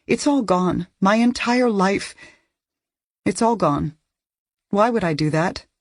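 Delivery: pushing the words out through grief; upset. A narrator's reading. Daughter to detective, her whole life gone, near tears. Grief over burned home.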